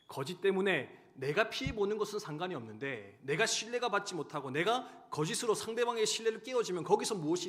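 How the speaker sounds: loud, rising preaching, slowing on the last line; loud impassioned sermon climax; fiery preaching. Acted. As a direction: drive the warning home to the congregation. Preaching at full force.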